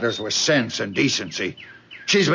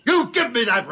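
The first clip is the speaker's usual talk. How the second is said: higher, commanding; angry demand; 8 semitones higher, faster than usual. Acted. Father demanding the ring from his daughter.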